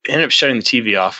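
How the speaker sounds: ordinary talk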